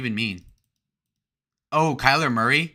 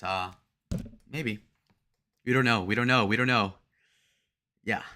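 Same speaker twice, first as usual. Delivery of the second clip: ordinary talk, nothing marked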